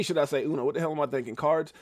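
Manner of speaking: normal talking voice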